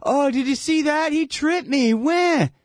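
high, whiny fake complaint; hammed-up crybaby whine; tattling high whimper; mock whining player. Mocking faking soccer players.

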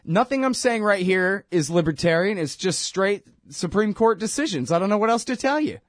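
high, firm mock judge laying down the law; imitating the judge. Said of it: "And the judge says".

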